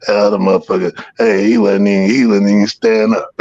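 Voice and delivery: usual speaking voice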